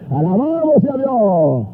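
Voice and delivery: drawn-out, ringing preacher's exclamation; praise shout. Real, not acted. Preacher breaks into praise before the crowd, exultant. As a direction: cry out praise, stretching each word.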